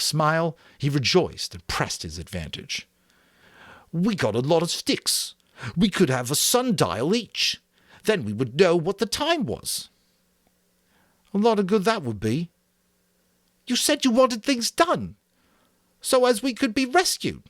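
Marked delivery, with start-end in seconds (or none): 3.9-9.9 put-on high, pleased boy pushing his idea; eager Piggy. A narrator's reading. Piggy pressing advantage. 11.3-12.5 put-on higher, dismissive boy; scoffing. A narrator's reading. Ralph mocking. 13.7-17.4 put-on high, earnest fat boy voice; a fussy, eager boy explaining; Piggy voice. A narrator's reading.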